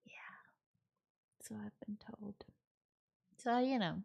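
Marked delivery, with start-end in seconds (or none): 0.1-2.4 dry whisper to herself; whispered aside. Real, not acted. She answers the roommate's "you're weird" jab to chat: soft whisper. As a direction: whisper it like a private joke. Wry aside after sigh.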